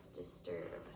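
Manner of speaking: normal talking voice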